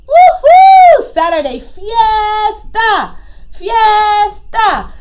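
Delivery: high whoop into drawn-out cheering; whoop, then stretch out each cheer; party cheer. Acted.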